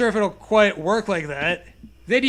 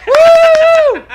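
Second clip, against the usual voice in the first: very high, loud whoop; over-the-top celebratory yell; mock cheer; 15 dB louder than usual. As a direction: whoop like a sarcastic fan.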